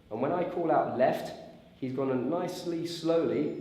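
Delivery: ordinary delivery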